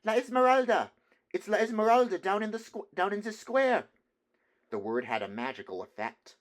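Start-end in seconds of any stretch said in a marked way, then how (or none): 0.0-3.9 high, loud excited shout; thrilled student yelling from a window. A narrator's reading. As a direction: call it out to the whole hall.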